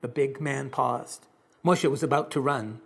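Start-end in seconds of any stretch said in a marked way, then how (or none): none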